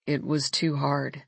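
low sad male voice. A narrator's reading. Jonah's sad admission.

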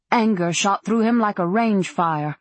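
ordinary delivery

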